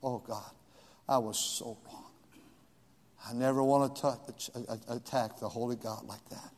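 soft, low whispered prayer; preacher retelling his whispered plea for forgiveness; whispered repentance. Retold or imitated. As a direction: breathe it out in shame. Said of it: "I said".

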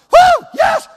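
high, loud whooping cheer; fired-up cheer. Retold or imitated. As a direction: whoop like a pumped young player. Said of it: "they're all fired up".

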